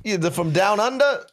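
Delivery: very high, put-on impatient barber voice. Barber demanding whether the customer wants a haircut. Barber character in improv skit.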